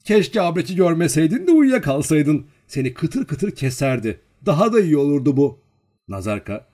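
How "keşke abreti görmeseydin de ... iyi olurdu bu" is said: high, loud acted taunt; playful giggly teasing. A narrator's reading. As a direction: tease loudly with lifted pitch, as a story character. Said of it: "güldü ve fıkırdadı".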